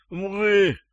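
high muffled "mgy" through a gag; gagged grunt. A narrator's reading. Gagged captive answers his interrogator, high muffled grunt. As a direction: grunt eagerly with mouth shut. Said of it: "отчаянно замычал".

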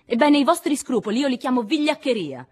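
loud, high furious parting shot; vehement, strained yell; violent shout. A narrator's reading. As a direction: hurl the accusation as you storm out. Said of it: "gridò con violenza".

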